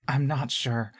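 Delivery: usual speaking voice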